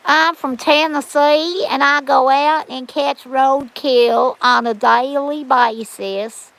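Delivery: put-on slow, drawn-out backwoods Tennessee accent. Imitating a backwoods lady from Tennessee, slow Tennessee accent. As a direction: imitate a backwoods Tennessee woman, vowels stretched. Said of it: "I can do the Tennessee accent".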